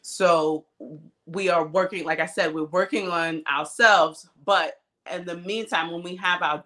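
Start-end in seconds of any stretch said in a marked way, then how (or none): none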